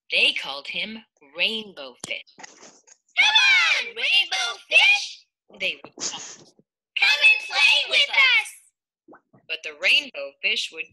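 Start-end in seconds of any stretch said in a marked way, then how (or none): 2.4-5.3 calling out. Acted. Friends calling to Rainbow Fish. 7.0-8.5 calling out. Acted. Friends calling to Rainbow Fish.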